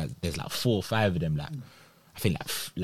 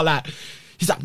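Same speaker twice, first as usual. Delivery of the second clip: usual speaking voice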